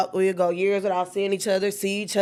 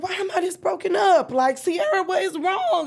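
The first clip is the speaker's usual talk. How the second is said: distraught questioning; 11 semitones higher than usual. Retold or imitated. Upset over grief, high.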